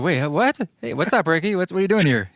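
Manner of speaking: mock surprise. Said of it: "You're like".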